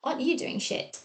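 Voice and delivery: mock-scold the listener; sarcastic telling-off, high and mocking; mock berating. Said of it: "to berate you and say".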